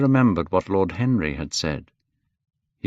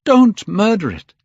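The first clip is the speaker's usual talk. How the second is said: high, loud horrified plea; cried out; 11 semitones higher, 7 dB louder than usual. A narrator's reading. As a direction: beg him loudly to stop. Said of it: "he cried".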